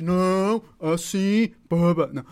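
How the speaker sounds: loud, forceful demonstration; strong voice. Shown as a demonstration. Teacher imitating a decided person, strong loud tone. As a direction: say it loud, as someone who is sure. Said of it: "Um tom de voz forte?".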